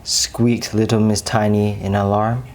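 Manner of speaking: ordinary delivery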